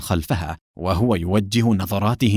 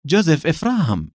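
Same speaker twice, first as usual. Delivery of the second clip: name repeated higher, in mock surprise; feigned astonishment; 7 semitones higher than usual. A narrator's reading. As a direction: act amazed at hearing the name. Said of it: "متظاهرا بالدهشة وهو يردد". (دهشة مصطنعة بنبرة أعلى)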